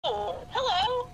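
high, slow put-on phone voice; sweet fake hello. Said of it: "she just put her fake voice on".

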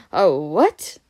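loud, baffled question, rising sharply; startled question. A narrator's reading. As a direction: blurt it out loudly in disbelief. Harry's retake, far louder question.

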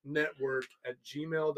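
drawn-out, laughing dictation; slow dictation. Shown as a demonstration. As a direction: spell each word out slowly. Re-dictates email slowly, laughing.

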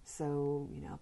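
ordinary talk, nothing marked

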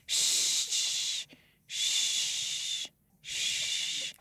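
soft, drawn-out shh; soothing shushing. Shown as a demonstration. Parent shushing the baby to sleep, soft and steady.

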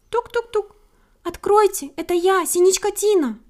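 high, loud chirping call; chirping bird. A narrator's reading. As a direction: knock and call out brightly. Bird knocks at hare's window.

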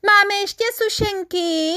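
ordinary delivery, nothing marked

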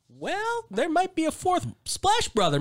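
singsong high imitation; high-pitched musing. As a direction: put on a high, teasing voice for their thought. Acted quote of others musing.

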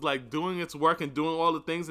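usual speaking voice

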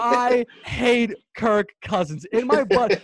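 slow, loud, drawn-out declaration of hatred; emphatic loathing. Real, not acted. Host confesses hatred of a quarterback, emphatic loathing. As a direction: spell out your loathing word by word, loudly.

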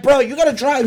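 high, loud panicky begging; panicked plea. Retold or imitated. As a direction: beg your friend to take the wheel.

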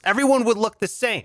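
usual speaking voice

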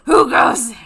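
loud guard challenge with a laugh; alarm shout. Pretend. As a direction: call out Who goes there, loud and laughing.

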